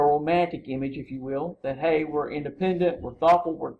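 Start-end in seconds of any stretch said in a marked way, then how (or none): none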